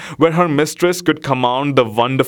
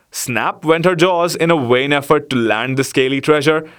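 ordinary delivery, nothing marked

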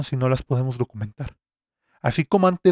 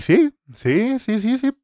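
excited; 10 semitones higher, 4 dB louder, slower than usual. Real, not acted. Sudden excited outburst after quote.

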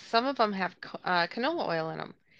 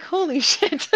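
a higher, laughing "holy shit"; laughing disbelief; 8 semitones higher, 4 dB louder than usual. Retold or imitated. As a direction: laugh through the shock of the price. Shocked at a 28-dollar price.

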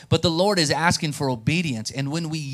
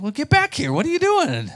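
high-pitched, incredulous scolding; exasperated boss; 12 semitones higher, 4 dB louder than usual. Retold or imitated. Dad calling his son back to work, exasperated. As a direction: demand it like a fed-up father.

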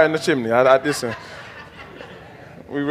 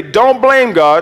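slow, raised, emphatic declaration; call-and-response lead; 4 semitones higher, 5 dB louder, much slower than usual. Shown as a demonstration. Preacher feeds the congregation a line to repeat, slow and emphatic. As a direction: say it out for the crowd to repeat.

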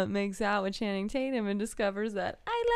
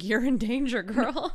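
mock warning; 5 semitones higher, 4 dB louder than usual. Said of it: "And I'm like".

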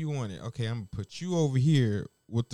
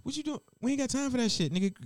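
high, mock-bossy kitchen chef barking orders; scolding head chef; 7 semitones higher than usual. Old chef scolding a young cook, mock bossy. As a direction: play the impatient old-school chef rushing a cook.

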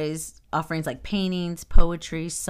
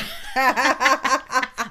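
high, loud burst of laughter; 8 semitones higher, 7 dB louder than usual. Real, not acted. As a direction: crack up at your friend's joke. Bursts out at the joke.

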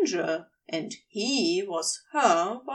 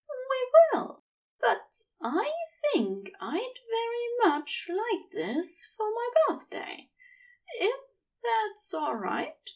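higher, tender wife's voice; adoring wife; 11 semitones higher than usual. A narrator's reading. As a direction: play the wife coaxing her husband sweetly. Wife's dialogue, read aloud.

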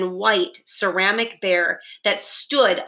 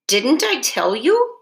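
higher, wide-eyed innocent question; 6 semitones higher, 3 dB louder than usual. A narrator's reading. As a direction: feign surprise, lifting the pitch. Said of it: "he asked innocently".

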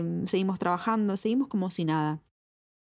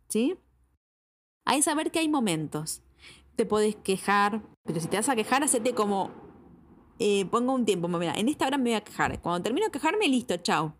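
ordinary talk, nothing marked

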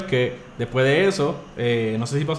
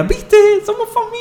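imitando a la madre; 5 dB louder than usual. Retold or imitated.

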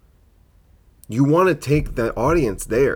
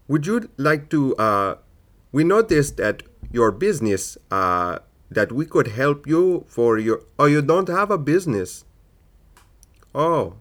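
put-on higher, lilting Indian accent of a cold caller; a sales caller in a hammed-up Indian accent; bad Indian accent; 5 semitones higher than usual. Scam caller phoning him, in a bad Indian accent. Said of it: "I don't have the best Indian accent".

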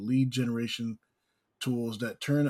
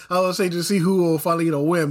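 laughing; 6 semitones higher, 8 dB louder, faster than usual. Real, not acted. Laughing while making his pick.

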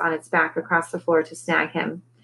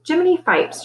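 high-pitched exasperated growl; mild growl of frustration; 11 semitones higher than usual. A narrator's reading. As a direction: blurt it out, annoyed. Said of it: "she growled".

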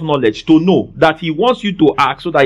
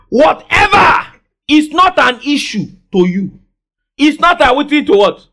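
emphatic repeat; 7 semitones higher than usual. Real, not acted. Preacher repeating his point to congregation, shouted emphasis. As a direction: shout the line again for emphasis. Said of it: "Let me say it again for emphasis".

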